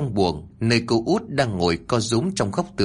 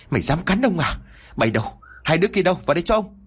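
enraged master shouting orders; furious yell; 7 semitones higher, faster than usual. Acted. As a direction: roar for the henchmen after being bitten. Landlord yells for his men.

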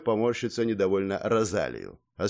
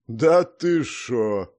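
put-on drawn-out, louder old man's amazement; amazed; 5 dB louder, much slower than usual. A narrator's reading. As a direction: stretch it slowly in disbelief. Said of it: "изумлённо произнёс Гордей".

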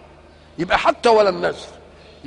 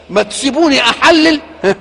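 loud, higher challenge in God's voice; indignant rebuke; 5 semitones higher, 9 dB louder than usual. Acted. Sheikh voicing God rebuking people who forbid food, loud indignant. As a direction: throw the question back at them, loud.

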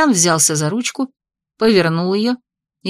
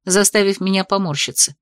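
plain reading voice

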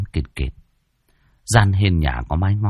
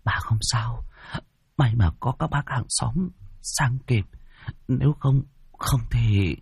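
soft, higher, halting grandmother's voice; close to tears; 4 semitones higher, 6 dB quieter than usual. Acted. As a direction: reassure the child softly while holding back tears. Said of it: "bà nội cũng rơm rớm nước mắt".

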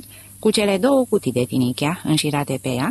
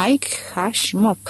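a touch of hoarseness, pitched up and held back; mildly rasped, lightly voiced idiot; hoarse voice; 6 semitones higher, slower than usual. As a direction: croak the name out. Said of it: "rosti idiotul cu glas răgușit".

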